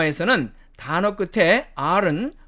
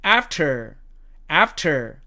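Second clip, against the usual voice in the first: American "after", front a and sounded r; American demo of "after", r kept; American accent; 3 semitones lower, much slower than usual. Shown as a demonstration. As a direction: say "after" as American "aff-ter". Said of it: "미국식 발음으로는".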